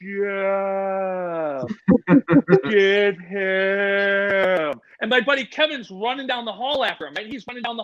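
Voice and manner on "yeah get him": long stretched-out movie slow-mo voice; slow motion. Retold or imitated. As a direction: imitate a slowed-down film shout. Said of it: "like, in slow-mo".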